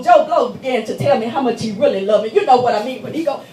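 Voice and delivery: ordinary talk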